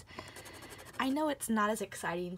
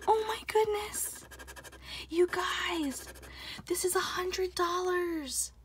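much higher, slow, thrilled; overjoyed; 9 semitones higher, 3 dB louder, slower than usual. Real, not acted. Telling viewers the ticket won a hundred dollars, elated. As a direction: savor it, elated and amazed.

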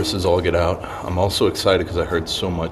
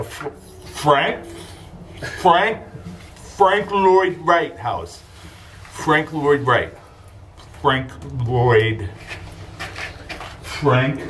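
repeated slow stammering; stumbling over the name; 8 semitones higher, much slower than usual. Pretend. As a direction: struggle to get the name out. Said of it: "I had a s-, quick stroke".

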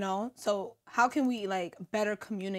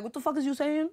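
higher, tough Dominican New Yorker snap; Dominican New Yorker attitude; 5 semitones higher, faster than usual. Retold or imitated. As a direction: throw some Dominican New York attitude at them. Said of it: "the Dominican in me, the New Yorker in me".

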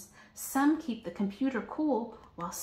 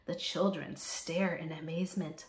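ordinary delivery, nothing marked

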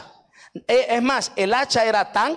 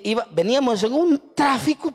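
ordinary talk, nothing marked

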